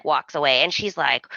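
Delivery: ordinary talk, nothing marked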